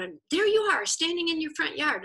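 normal talking voice

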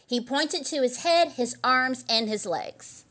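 ordinary delivery, nothing marked

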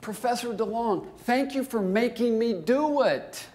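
nearly yelling with exasperated disbelief; exasperated excitement. Real, not acted. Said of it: "the last sentence makes me crazy".

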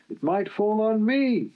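high, frightened wail. Acted. Stuck Bulgy wails to Duck in fear, high. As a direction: plead in panic. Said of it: "wailed Bulgy".

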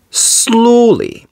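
high, stretched, sliding down; drawn-out slowly. A narrator's reading. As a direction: stretch the word to match it. Narrator draws out the word.

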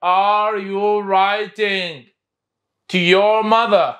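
very slow, stretched; teacher modelling slowly; slow model reading. Shown as a demonstration. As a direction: say it slowly for the class. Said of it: "يلا".

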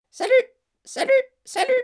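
very high, squeaky parrot voice; squawking parrot. A narrator's reading. Parrot suddenly greeting Matilda and Fred, squawking. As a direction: cry out like a parrot. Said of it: "lui lança le perroquet".

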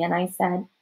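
usual speaking voice

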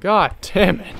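frustrated outburst. Real, not acted. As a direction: snap it out in frustration.

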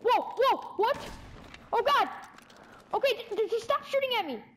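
high, startled, stammering plea; alarmed protest. Real, not acted. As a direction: protest loudly while under fire. Being shot at in game.

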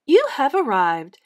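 brisk machine-lady voice; Santa Nav lady. A narrator's reading. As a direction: announce arrival like a bossy sat-nav. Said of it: "said the Santa Nav".

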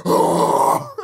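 huge high spear roar; very loud imitated war cry; Roman Reigns. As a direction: roar as if charging to tackle someone. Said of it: "You know how Roman Reigns is?".

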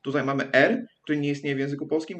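normal talking voice

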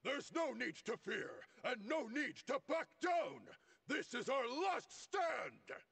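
rallying shout. Acted. Commander urging his men not to fear, high and rousing. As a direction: fire up the troops for a last stand.

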